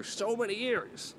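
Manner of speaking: high, drawn-out mock yearning; hammed-up longing. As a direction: stretch the words out in a high voice.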